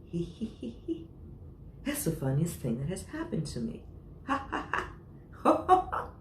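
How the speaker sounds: acted giggle growing into big ho-ho laughter; giggling. Elephant giggling at the joke, building up. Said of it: "He began to giggle".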